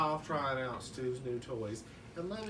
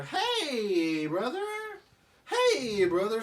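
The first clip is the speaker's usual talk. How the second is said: higher, louder booming hey brother; hearty greeting; 9 semitones higher, 9 dB louder, much slower than usual. Acted. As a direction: call out cheerfully at the door. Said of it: "says Drew".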